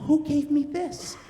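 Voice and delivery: put-on gasping little-kid wonder, slow; excited child. Three-year-old opening his Christmas present, acted excitement. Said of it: "he's so excited".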